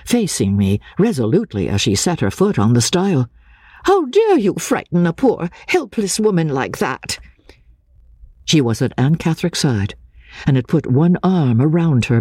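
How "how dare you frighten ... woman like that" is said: a lightly voiced older woman, high and scolding; indignant countrywoman. A narrator's reading. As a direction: tell him off for scaring her. Mrs Clements scolds a stranger.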